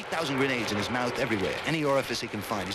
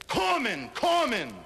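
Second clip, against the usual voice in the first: much higher mock shout of the name; yelling a name; 11 semitones higher, much slower than usual. John Payne yelling to his dying buddy, mock shout. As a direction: call out across the battlefield, hammed up. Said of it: "He yells out".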